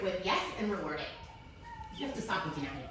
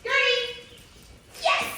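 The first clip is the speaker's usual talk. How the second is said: excited call; 13 semitones higher, 4 dB louder, slower than usual. Real, not acted. Trainer calls the puppy.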